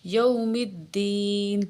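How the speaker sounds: الدین with the doubled dal stressed hard; slow, firm tajweed. Shown as a demonstration. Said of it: "تھوڑا سا سختی سے پڑھیں گے".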